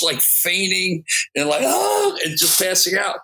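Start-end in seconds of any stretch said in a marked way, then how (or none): 1.6-2.2 mock swooning squeal. Girls fainting at Beatles concert.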